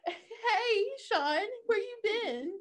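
high, slow dreamy voice; mock Emma swooning. Imitating Emma greeting Sean, high and slow. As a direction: gush like she's smitten. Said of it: "She's like".

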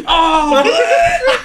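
loud disgusted "oh!" with a laugh; disgusted shout. Retold or imitated. Grandma smells the fart.

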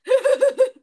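loud high laugh. Real, not acted. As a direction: burst of laughter. Laughing fit continues.

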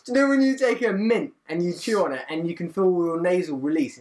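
ordinary delivery

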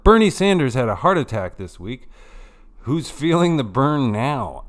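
lightly mock a smug rival; Hillary Clinton impression. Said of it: "asked Hillary Clinton".

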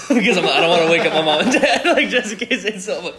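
high, loud, laughing while talking. Real, not acted. Storyteller explains sneaking past his parents, laughing. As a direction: talk through your own laughter.

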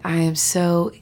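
ordinary talk, nothing marked

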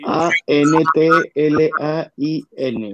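slow, clear spelling out; over enunciating. Shown as a demonstration. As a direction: spell it slowly for the listeners. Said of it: "hay que deletrearlo". (deletreando lento para la audiencia)

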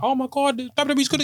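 high, gushing fan impression; mocking fan voice. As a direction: mimic an overexcited fan. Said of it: "everyone's like".

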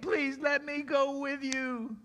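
high, drawn-out plea; begging. Acted. Healed man to Jesus at the boat, begging. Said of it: "the man with the demon possessed begged".

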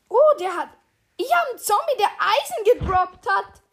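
high, loud, excited outburst; excited surprise. Real, not acted. Player tells friend about a rare zombie drop, excited. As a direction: blurt it out, amazed.

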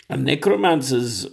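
raspy voice from a cold; hoarse voice. Real, not acted. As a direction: croak it through a sore throat. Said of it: "this dang cold that's gripped me by the throat".

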